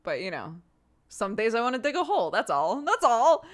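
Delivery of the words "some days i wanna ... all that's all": exasperated outburst. Real, not acted. Venting exasperation.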